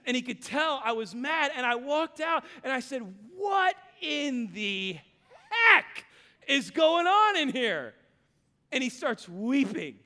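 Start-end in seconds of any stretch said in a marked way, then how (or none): 3.4-7.9 slow build to a loud, higher 'heck'; bursting in on the mess; angry dad yell. Retold or imitated. Dad storms into messy garage.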